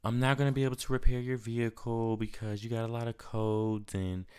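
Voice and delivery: mild imitation of a mechanic letting him down; quoting the mechanic. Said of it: "And she says".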